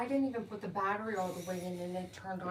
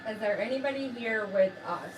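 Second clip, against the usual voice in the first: calling out; 8 dB louder than usual. Acted. Calling out to spirits.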